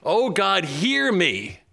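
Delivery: preacher crying out Daniel's prayer to God; fervent prayer. Acted. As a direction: beg God earnestly, rising.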